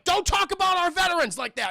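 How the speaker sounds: high, loud, indignant yell; mock angry shout. Mister Tubbs defends the armless soldier, mock angry shout. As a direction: roar a hammed-up reprimand.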